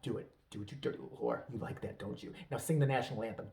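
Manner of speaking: low, hushed commanding voice; mock dominant lover. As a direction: murmur the orders like a hammed-up seducer. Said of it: "he was gonna be like".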